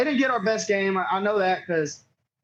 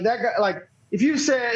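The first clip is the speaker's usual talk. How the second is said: ordinary talk, nothing marked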